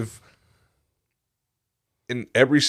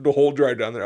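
normal talking voice

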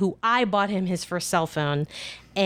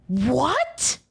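higher, rising horrified question; 5 semitones higher than usual. Retold or imitated. Friend reacting to shocking phone news, horrified. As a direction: let the word climb in dismay. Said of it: "look of horror on her face".

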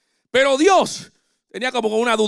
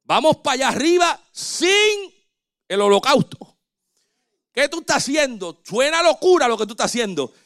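doubting son; 5 semitones higher than usual. Retold or imitated. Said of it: "los hijos a veces le dicen al padre".

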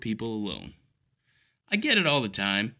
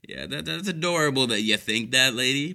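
ordinary talk

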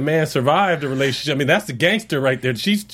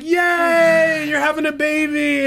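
mock cheer; 11 semitones higher, much slower than usual. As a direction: squeal it like a party guest. Mocking family's cheerful welcome.

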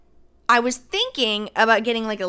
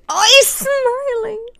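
cooing squeal; 14 semitones higher, 6 dB louder, slower than usual. Real, not acted. Cooing over axolotl photo.

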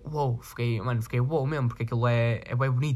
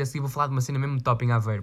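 ordinary talk

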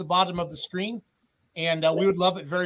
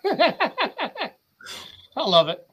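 ordinary delivery

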